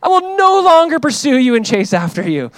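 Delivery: a preacher mocking Saul's fake repentance; mock groveling Saul. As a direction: overdo the tearful apology. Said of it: "And he goes".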